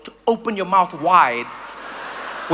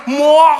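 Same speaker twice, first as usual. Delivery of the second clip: loud, high open "Mo-" choking off into gasps; greeting that freezes in the throat; mock choking on cold air; freezing throat; 5 semitones higher, 7 dB louder than usual. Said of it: "freeze the shit out of your throat".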